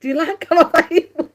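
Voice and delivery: high, loud words through laughter; laughing. Real, not acted. Preacher jokes to the audience, laughing hard. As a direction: talk while cracking up. Cracks up at own joke.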